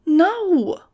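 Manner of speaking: high, loud flat refusal; emphatic no. A narrator's reading. Nudge refusing Max's search for answers, emphatic. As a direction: slam the door on the idea. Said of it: "shook her head emphatically".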